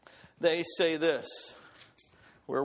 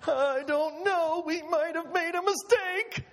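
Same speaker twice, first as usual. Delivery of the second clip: frightened people; 10 semitones higher than usual. Acted. Frightened Israelite at the mountain.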